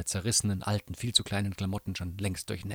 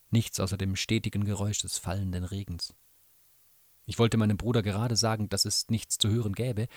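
usual speaking voice

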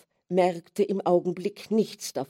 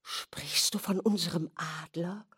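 stiff, fearful whisper; frightened whisper; 6 dB quieter than usual. Acted. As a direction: whisper it as if the bird might wake. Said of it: "flüsterte sie starr und ängstlich". (starres, ängstliches Flüstern)

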